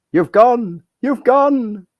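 high loud call-out. Real, not acted. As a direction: shout they've frozen. Host frozen on screen.

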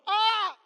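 loud, high mock scream; sudden high yelp, acted out; retold shriek. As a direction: imitate a startled shriek into the phone. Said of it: "normally people say hello, but she goes".

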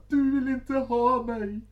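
very high, loud slow wail; mock wailing. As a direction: wail like a sobbing drama queen. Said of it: "hon ligger och tjuter bara". (hög, spelad tjutande gråt)

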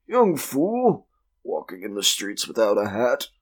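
put-on grumbling voice, high at first; disapproving old clubman. Club member about the fainted young man, disapproving. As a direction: scold in a character voice, dropping after the start.

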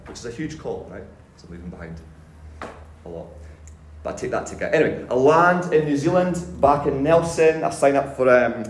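ordinary delivery

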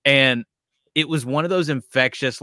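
ordinary talk, nothing marked

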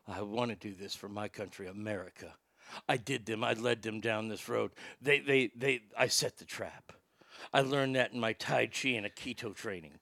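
very low, hushed action-star impression; Steven Seagal. Host imagining the actor's patriotic excuse, low mumbled impression. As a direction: drop the voice way down and keep it soft, mock-solemn. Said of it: "you know what Seagal will do? He goes".

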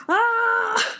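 high, short burst of a yell; excited shriek. Retold or imitated. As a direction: squeal with excitement. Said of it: "And I was like".

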